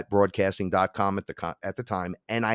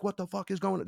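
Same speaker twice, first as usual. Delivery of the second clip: mild mock scream; 11 semitones higher, 5 dB quieter than usual. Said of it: "They were screaming".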